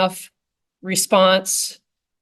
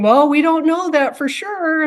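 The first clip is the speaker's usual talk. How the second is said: much higher, called-out doubtful reply; mock trooper; 9 semitones higher than usual. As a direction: play a wary trainee yelling back. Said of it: "he just kept yelling".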